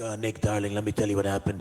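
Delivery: lightly voiced older lady storyteller; a hint of a smoking actress confiding; Sheree. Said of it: "she smokes and talks like this".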